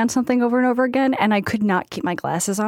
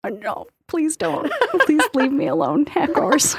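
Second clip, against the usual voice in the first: higher, drawn-out mock plea; mock pleading; 6 semitones higher than usual. Podcaster begging imagined hackers, mock pleading. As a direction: playfully beg to be left alone.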